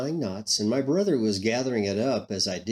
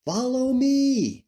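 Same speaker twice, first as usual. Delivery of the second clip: high, loud, long-held call; calling out invitingly; 13 semitones higher, 6 dB louder, much slower than usual. A narrator's reading. Man on the shore calls the fisherman once more, high drawn-out invitation. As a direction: call out an open invitation, holding the last word. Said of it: "Again he said".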